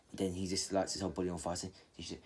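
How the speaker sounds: ordinary delivery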